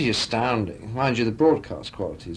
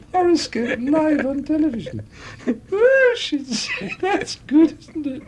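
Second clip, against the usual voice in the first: very high, silly showgirl voice; squeaky, hammed-up feminine impression; Amy MacDonald; 14 semitones higher, 4 dB louder than usual. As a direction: squeal it in comic falsetto. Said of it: "she said".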